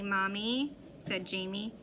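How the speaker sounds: plain reading voice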